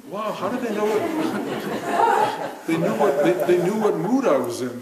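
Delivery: laughing in delighted wonder. Real, not acted. Telling the audience about songs in his car, laughing. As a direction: giggle through the words in amazement.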